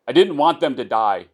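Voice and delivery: crying out a confession; desperate cry. Acted. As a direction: blurt it out in despair.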